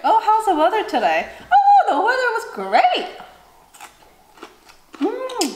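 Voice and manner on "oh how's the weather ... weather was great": much higher, laughing chit-chat; mock small talk. Making pointless small talk with a new friend, laughing higher voice. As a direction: gush over the weather.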